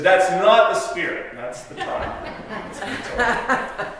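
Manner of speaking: loud then fading into laughter; said while laughing; laughing punchline. Retold or imitated.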